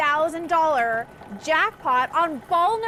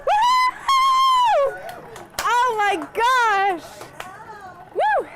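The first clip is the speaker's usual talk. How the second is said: high, loud whoops of joy; whooping cheer; 8 semitones higher, 7 dB louder, much slower than usual. Real, not acted. Bingo host cheering the winner, excited whoop. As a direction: cheer like you just won.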